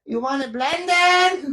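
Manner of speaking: high, slow, stretched-out "blended"; mock screech imitation. Friend retells the lady's order question, mock screech. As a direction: imitate the lady's heavy accent, screeching the last word. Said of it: "Then she started screaming it at us".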